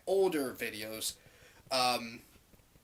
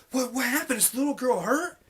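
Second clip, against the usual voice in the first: high, louder alarmed questions; retold scared questioning; 7 semitones higher, 5 dB louder than usual. Clerk asking whether the little girl is hurt, acted scared. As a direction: act out a worried, urgent check. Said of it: "I'm fucking scared 'cause they're".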